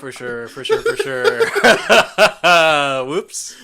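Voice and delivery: laughing through the words; giggling for sure. Real, not acted. Joking about Polar Express.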